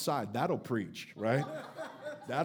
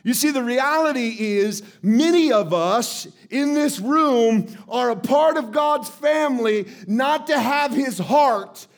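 loud, higher preaching drive; 6 semitones higher, 7 dB louder than usual. Real, not acted. Pastor challenging his congregation, loud preaching. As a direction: hammer the point to the room.

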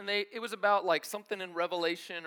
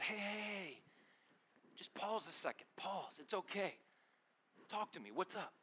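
soft, soothing and much quieter; gentle coaxing; 12 dB quieter than usual. Retold or imitated. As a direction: gently get an upset buddy to open up. Calming his upset buddy.

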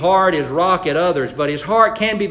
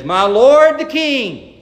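calling out; 8 semitones higher, 7 dB louder, slower than usual. Retold or imitated. David calling out to Saul.

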